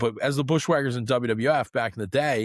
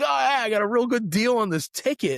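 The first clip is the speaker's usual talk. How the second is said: higher-pitched, mildly excited brag; mock pleased fan; 9 semitones higher than usual. Co-host voices a fan with cheap tickets, mild mock excitement. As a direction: play a fan lightly chuffed with a bargain. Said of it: "You're like".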